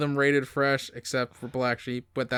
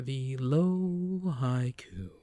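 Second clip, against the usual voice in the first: announcer voice; 4 dB quieter, much slower than usual. Acted. Announcing segment title.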